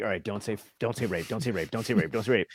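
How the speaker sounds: muttered under the breath to yourself; low, hushed self-warning; a soft nervous mantra to yourself; quiet inner voice. Retold or imitated. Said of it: "and you're like".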